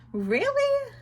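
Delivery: high incredulous really. Real, not acted. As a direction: scoff in disbelief at the character. Outraged reaction to character.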